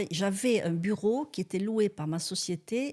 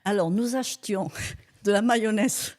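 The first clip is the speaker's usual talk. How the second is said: chuckling through the words; amused, laughing as she admits it; 5 semitones higher, 4 dB louder than usual. Real, not acted. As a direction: laughs while recalling the smuggled mayonnaise. Amused confession about mayonnaise.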